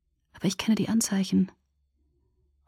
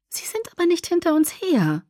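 much higher, light female character voice; Alice's worried voice; 10 semitones higher than usual. A narrator's reading. Alice objecting as Carlyle looks alarmed, high and uneasy.

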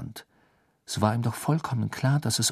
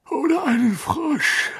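words stretched through a yawn, much higher; 4 dB louder than usual. A narrator's reading. Exhausted Harry joking, yawning. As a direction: yawn the line out, worn out. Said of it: "gähnte Harry".

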